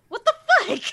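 high, stunned and loud; stunned what-the. Acted. Lydia stares at the bolt in her shoulder, stunned high blurt. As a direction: blurt it out in shock. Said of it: "she just stands there and kinda does, like".

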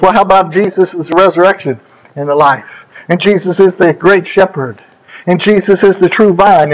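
ordinary talk

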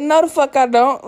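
irritated out loud. Retold or imitated. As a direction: shoot her down, fed up and loud. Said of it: "irritated out loud".

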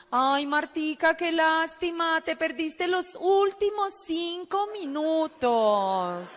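very slow, drawn-out coaxing; milk every word with mock regret; syrupy pity. Recruiter laments what Martica missed, syrupy.

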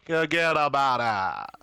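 mock New York accent. Joking with friends in a hammed-up New York accent. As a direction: ham up a New York tough guy.

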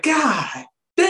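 a hint of stunned exclaim; lightly voiced shock; mock dismay. Host imitating the stunned driver, mock dismay. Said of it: "You're like".

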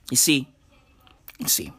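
usual speaking voice

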